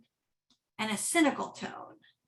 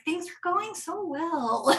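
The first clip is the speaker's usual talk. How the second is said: ordinary talk, nothing marked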